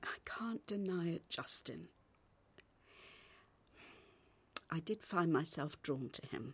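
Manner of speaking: barely voiced, hushed admission; hushed confession. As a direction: confess it under your breath. Confessing to her partner.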